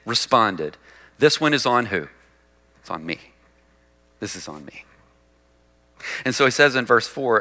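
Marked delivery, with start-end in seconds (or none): 2.8-4.9 much softer and lower; quiet, sincere admission of guilt; hushed confession. Acted. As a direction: own it almost under the breath. Voicing David owning his sin.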